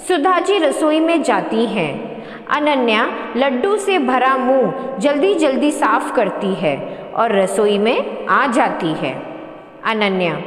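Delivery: ordinary delivery, nothing marked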